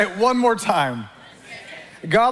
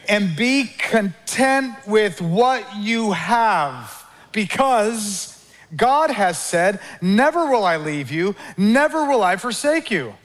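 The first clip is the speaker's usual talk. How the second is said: slow, drawn-out call and response; slow recitation; slower than usual. Shown as a demonstration. Preacher leading congregation in slow recitation. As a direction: lead the congregation word by word, slowly. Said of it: "say it again".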